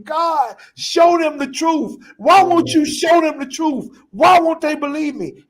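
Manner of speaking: high, loud anguished plea; crying out to God. Retold or imitated. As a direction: cry out to God from your knees. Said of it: "I'm on my knees crying".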